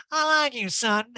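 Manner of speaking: high, dreamy mock Erica; mock a doped-up reality star; woozy painkiller voice. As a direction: drift up high like she's loopy on pills. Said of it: "on a lot of painkillers and was like".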